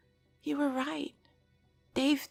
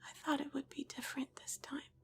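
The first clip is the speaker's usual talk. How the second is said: sad, much softer near-whisper; quietly; 5 semitones higher, 12 dB quieter than usual. Acted. Cass to Des in the kitchen, sad whisper. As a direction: confess it barely above a breath. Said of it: "she says quietly".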